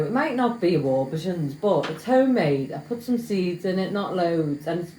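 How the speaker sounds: normal talking voice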